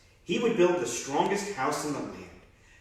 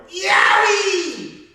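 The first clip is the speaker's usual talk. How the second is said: very high, loud yelp of pain; scream; 11 dB louder than usual. Acted. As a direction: yelp as if scalded. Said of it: "He leaped with a scream".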